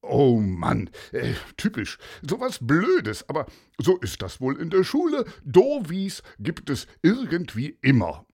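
put-on loud grumbling old detective; old inspector. Inspector Paulus speaks.

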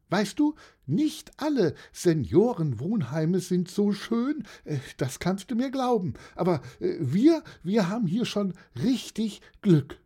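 high-pitched aunt, earnest; high, sincere aunt counting her blessings; old aunt, thoughtful. Acted. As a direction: confide in a high auntie voice.

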